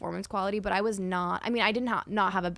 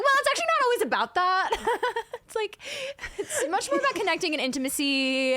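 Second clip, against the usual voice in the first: high, mock-earnest correcting voice; hammed-up, high-pitched gentle objection; imitating lesbians; 11 semitones higher than usual. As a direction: play a well-actually objector, pitched way up. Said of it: "the lesbians are like".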